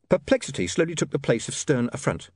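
plain reading voice

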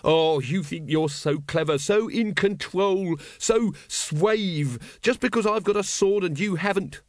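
high, worked-up rant; jeering villain. Acted. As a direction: taunt him in a heated, high voice. Wonse rants at the patrician.

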